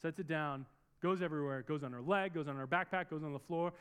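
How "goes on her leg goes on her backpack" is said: ordinary talk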